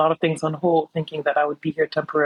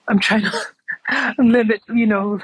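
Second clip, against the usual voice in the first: laughing; 6 semitones higher, slower than usual. Real, not acted. Laughs mid-sentence.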